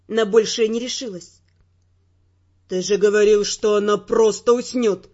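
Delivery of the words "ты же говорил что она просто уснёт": low, loud male voice, furious; lowered male voice, enraged accusation; angry Theo. Acted. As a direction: snap at him in a man's angry voice. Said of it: "разгневанный голос Тео".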